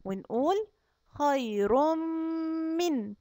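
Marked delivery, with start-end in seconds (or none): 1.1-3.1 خير من with the ر merged into a lip-closed م; idgham of ra into mim. Shown as a demonstration. Teacher showing kids the merged ra and mim, idgham. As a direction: close the lips and blend خير into من. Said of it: "ونقفل شفايفنا عليهم ونقول".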